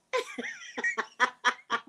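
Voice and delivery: a burst of high-pitched laughter; sudden high laugh out of nowhere. Real, not acted. Chat joke sets her off.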